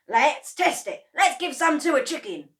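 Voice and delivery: high, loud, gleeful urging; gleeful cheering. A narrator's reading. Mister Cranky urging George, high and loud. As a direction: whoop him on.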